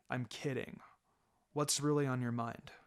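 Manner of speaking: relaxed mentor, gently probing; calm sensei voice. Acted. Kakashi voiced lower, softer.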